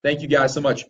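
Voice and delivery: normal talking voice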